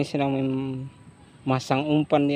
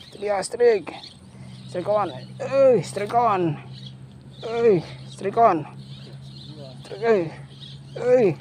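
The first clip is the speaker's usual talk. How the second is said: high-pitched calls with falling ends; excited strike call; 7 semitones higher, 4 dB quieter than usual. Real, not acted. Angler tells his friends it's a strike, excited calling.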